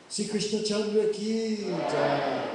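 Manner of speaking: loud, slow chanted jai cry; devotional call. Real, not acted. Preacher calls out praise to Krishna for the audience. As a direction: lead the crowd in a victory call.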